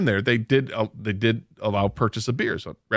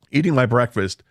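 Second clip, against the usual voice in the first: ordinary talk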